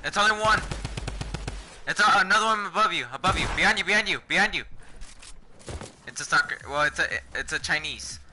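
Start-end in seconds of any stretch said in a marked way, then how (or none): 0.0-4.6 fast loud warning; urgent callout. Real, not acted.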